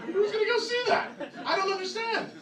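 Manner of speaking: very high, incredulous whine; exasperated disbelief. Acted. Comic baffled by an R-rated Hansel and Gretel, high-pitched disbelief. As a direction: squeal in baffled protest.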